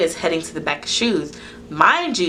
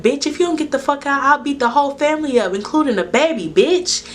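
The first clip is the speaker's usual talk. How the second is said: high, heated outburst, hard final word; worked-up retold confrontation; re-enacted angry threat; 8 semitones higher than usual. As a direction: threaten them in a raised, pushed pitch. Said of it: "I said it just like that".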